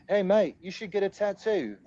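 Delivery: attempted British accent, pitched high; botched, high-voiced British accent; a jokey, badly faked British accent. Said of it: "I can't do the accent".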